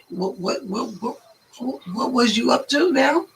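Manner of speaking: ordinary talk, nothing marked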